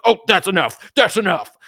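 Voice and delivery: high, loud put-on exasperated voice; mock fed-up host. Imitating the angry host ending the show, high and loud. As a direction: shut it down in a huff, hammed up. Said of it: "And he's like".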